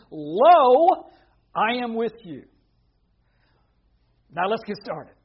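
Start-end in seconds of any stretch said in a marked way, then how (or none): 0.1-2.4 loud, raised preacher's cry; ringing proclamation. Retold or imitated. Preacher proclaiming Jesus's promise to fearful Gideon, loud and emphatic. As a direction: boom out the first word, then hold the force.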